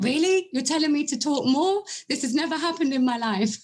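high, incredulous mock amazement; retold astonishment. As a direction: play up your disbelief.

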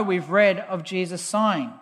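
normal talking voice

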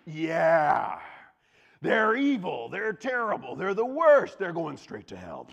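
high, loud gleeful piling on; mock gossip frenzy; eager agreement. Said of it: "and you're like".

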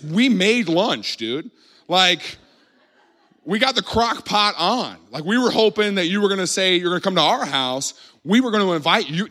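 high, aggrieved mock complaint; wounded protest. Host who cooked for Jesus protests, mock aggrieved.

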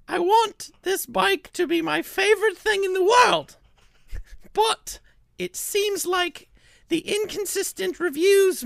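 high, piercing reviewer voice; high-pitched put-on voice of an upset customer; Ginny's voice. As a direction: read her review in a high, whiny voice. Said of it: "This is what Ginny sounds like to me".

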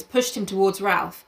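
ordinary delivery

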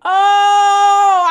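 a loud, high, held "oh"; loud exclamation. Retold or imitated. She sees the mark on her face, loud. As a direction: belt out a long, outraged "oh". Reacting to the ex's blow.

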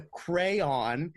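"crayon" stretched as CRAY-on, both syllables full; cray-on, like Crayola. Shown as a demonstration. Host argues from the brand name, cray-on pronunciation. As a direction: insist on "crayon" with a clear cray and on. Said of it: "because it's fucking Crayola".

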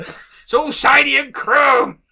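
very loud, high, drawn-out catchphrase; hammed-up roar. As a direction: stretch SHINY and CHROME into a slow mock war cry. Catchphrase repeated, bigger and drawn out.

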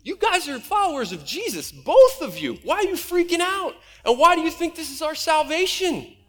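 high, pointed disbelief at his friends; baffled, challenging a pair of panicking believers; incredulous disbelief. Retold or imitated. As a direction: throw the question back in mock shock. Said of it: "And I'm like".

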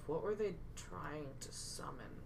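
soft, slow in-character musing; mumbling to oneself. Pretend. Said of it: "I'm just gonna mumble to myself".

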